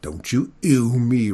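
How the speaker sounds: rumbling dragon. A narrator's reading. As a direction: rumble it slowly as a warning. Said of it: "rumbled the dragon".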